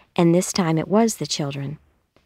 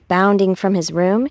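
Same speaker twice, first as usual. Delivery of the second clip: ordinary delivery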